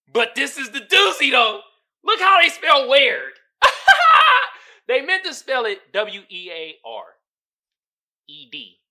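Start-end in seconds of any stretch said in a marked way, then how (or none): 3.6-4.8 high, shouted cackle of disbelief; loud laugh burst. Real, not acted. Host cracking up at a misspelled listing with a loud laugh.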